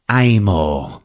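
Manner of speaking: normal talking voice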